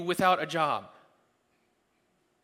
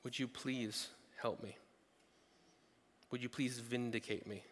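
hushed, low-pitched pleading prayer; prayerful plea; 7 semitones lower, 15 dB quieter than usual. Acted. David praying to God for vindication, hushed plea. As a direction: pray it very softly, asking for help. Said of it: "to a prayerful plea".

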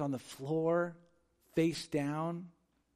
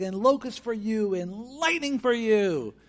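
mock raging God; 6 semitones higher, 4 dB louder, slower than usual.

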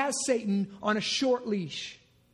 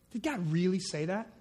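low, softer sarcastic doubt; 4 semitones lower, 3 dB quieter than usual. Acted. Preacher voicing Satan's sarcastic doubt to the congregation, low and soft. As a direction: drip sarcasm on the question. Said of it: "I can just hear the sarcasm".

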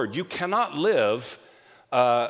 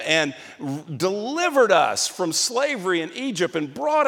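incredulous outburst; 6 semitones higher than usual. Acted. Imagined response of the people.

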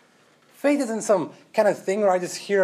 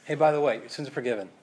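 soft offhand by-the-way; afterthought; 6 semitones lower, 4 dB quieter, faster than usual. Pretend. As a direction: call it back lightly while leaving. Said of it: "Walking away".

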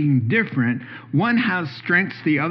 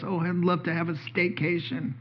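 much softer, dreamy voice; wife's gentle reply; 2 semitones higher, 8 dB quieter than usual. Retold or imitated. Wife proposing a staycation, soft and content. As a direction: say it softly and contentedly. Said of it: "She goes".

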